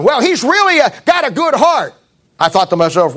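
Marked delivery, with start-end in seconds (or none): 0.0-1.9 high mock voice of a doting mother; preacher mimics a mother defending her wayward son, high-pitched; imitating a mother. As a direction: lift the voice like a fond mother excusing her son. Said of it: "Lady told me".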